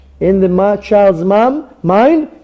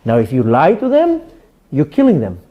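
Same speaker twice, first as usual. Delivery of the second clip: usual speaking voice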